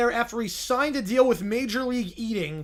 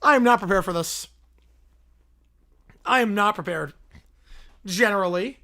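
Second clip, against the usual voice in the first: normal talking voice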